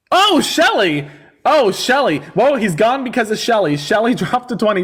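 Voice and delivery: high, delighted exclamation to a donor; big, high-pitched thrilled reaction; excited shout-out. Real, not acted. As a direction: whoop the name with showy gratitude. Cheering a viewer's gift.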